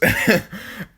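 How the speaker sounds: a sudden, high, loud laugh; burst of laughter. Real, not acted. Narrator cracks up telling the friend the story, loud high laugh. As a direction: crack up at your own punchline.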